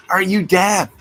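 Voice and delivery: yelling at the woman who won't answer; exasperated shout. Real, not acted. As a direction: snap it out loud in disbelief. Scolding woman who ignores him.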